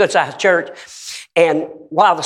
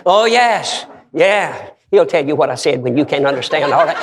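usual speaking voice

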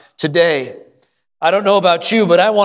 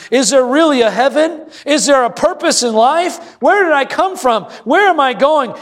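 emphatic questions climbing steadily higher in pitch; building impassioned preaching; 5 semitones higher than usual. Real, not acted. Pastor voicing the world's doubts to the congregation, building preaching.

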